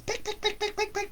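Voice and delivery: high little peck peck peck; high pecking sound. A narrator's reading. Storyteller voicing the sparrow's pecking, high. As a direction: peck it out like a tiny bird.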